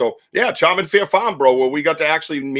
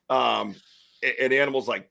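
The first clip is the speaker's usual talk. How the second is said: normal talking voice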